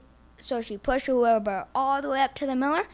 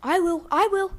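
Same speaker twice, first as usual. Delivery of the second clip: higher and louder cow voice; the cow volunteers, higher and louder; eager cow; 6 semitones higher, 6 dB louder than usual. Acted. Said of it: "said the cow".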